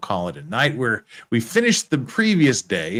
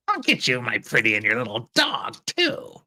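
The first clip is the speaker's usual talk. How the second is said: wicked witch voice. Acted. Cackling witch threat, dog reply.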